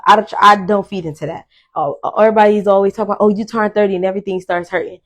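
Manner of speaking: ordinary talk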